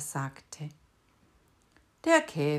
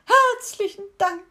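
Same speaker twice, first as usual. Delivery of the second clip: sobbing thanks; 11 dB louder than usual. A narrator's reading. Beetle sobbing after the departing cricket, high and tearful. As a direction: sob out the thanks.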